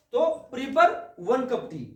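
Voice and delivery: each word drawn out very slowly; slow dictation. Shown as a demonstration. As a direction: pace it for students copying it down. Dictating English sentence to students.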